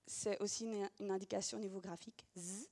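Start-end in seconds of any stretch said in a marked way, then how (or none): 2.4-2.7 "Z" as a soft buzz; isolated phoneme. Shown as a demonstration. Teacher repeating the sound with its sign, soft buzzed "Z". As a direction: softly buzz "Z" with no vowel after it. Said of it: "bien différencier".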